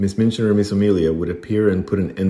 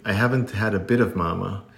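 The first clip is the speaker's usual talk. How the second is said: usual speaking voice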